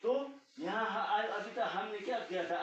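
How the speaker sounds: ordinary delivery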